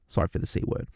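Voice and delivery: quick whispered apology; whispered aside. Real, not acted. Apologising to listeners for swearing, in a whisper.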